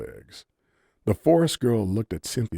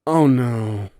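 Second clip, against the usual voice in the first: groaning; 5 semitones higher, 8 dB louder than usual. A narrator's reading. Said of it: "Cynthia groaned".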